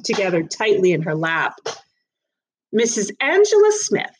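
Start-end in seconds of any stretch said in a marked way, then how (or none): 2.7-4.2 high, clipped-off reading of "Missus Angela Smith" with no middle name; officious magistrate reading a name. A narrator's reading. Magistrate misreads the defendant's name, high and brisk. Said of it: "Mister Gibbons corrected".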